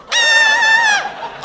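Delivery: very high, loud shriek of shock; shocked scream. Real, not acted. Reacting to the dessert name, a loud shocked shriek. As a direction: shriek in horror.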